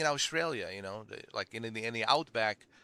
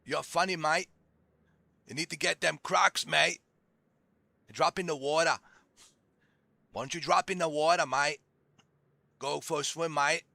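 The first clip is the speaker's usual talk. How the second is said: higher-pitched hammed-up Aussie; outback Australian accent; 4 semitones higher, 4 dB louder than usual. Mock local sending someone to the crocs, outback Australian accent. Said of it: "a outback Australian".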